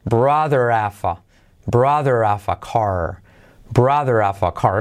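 drilled, blended "brother have a car"; dropped H. Shown as a demonstration. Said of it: "se cae también".

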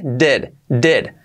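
"did" with i nearly e; relaxed English vowel. Shown as a demonstration. Teacher drilling the vowel, "did" almost "ded". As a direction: repeat "did" sounding close to "ded". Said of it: "La I casi, casi como una".

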